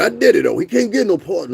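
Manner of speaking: high, loud gloating mock voice; mocking online commenters. Mocking chat commenters who think she'll walk, high and loud. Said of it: "y'all motherfuckers still online talking about".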